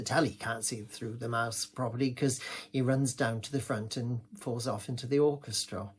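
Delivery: ordinary talk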